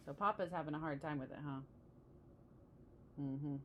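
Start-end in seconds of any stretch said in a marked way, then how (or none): none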